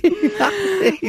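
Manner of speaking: laughing while denying it; stifled giggles. Real, not acted. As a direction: giggle breaking through the words. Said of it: "Doch, du lachst".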